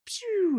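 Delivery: high squeaky pew; laser pew. Retold or imitated. Imitating Rigby's last shot, a squeaky pew. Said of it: "And he ch-, ch".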